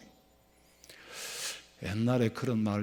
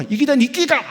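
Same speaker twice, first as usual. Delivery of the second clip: higher, louder Gyeongsang dialect shout; louder Gyeongsang retort; 13 semitones higher, 11 dB louder than usual. Retold or imitated. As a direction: throw it back even louder in Gyeongsang dialect. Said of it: "경상도 사람이 뭐라 그랬다고요?".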